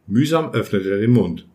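ordinary delivery

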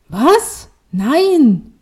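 high, loud startled outburst; shocked disbelief. A narrator's reading. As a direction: blurt it out in stunned denial. Daughter hears speaker is her mom.

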